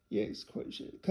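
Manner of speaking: breathy self-check under the breath; whispered trial of Yitzchak; whispered repeat. Real, not acted. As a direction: whisper the name to yourself. Reader repeats name to herself.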